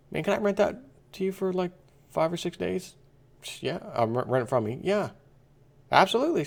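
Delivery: high-pitched mock neighbor happy to lend; eager neighbor. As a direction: play the cheerful bike owner in a high voice. Bike owner voiced in skit.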